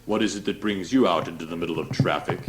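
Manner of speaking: sneering. Acted. Priest sneering at Klaus in traffic, snide. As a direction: sneer the question down at him. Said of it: "he said, sneering".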